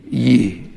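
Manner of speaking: Ye for you, unrounded lips; a low, relaxed Southern Ye. Shown as a demonstration. Native drilling the singular Ye, Southern pronunciation. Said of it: "Don't have to push your lips out".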